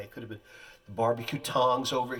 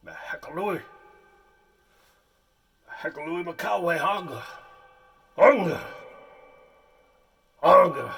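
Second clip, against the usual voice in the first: loud bellowing caveman gibberish, higher; 6 semitones higher, 10 dB louder than usual. Acted. Auditioning chieftain for weeping director, loud caveman bellow. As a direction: roar it like a prehistoric chieftain. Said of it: "Be a caveman".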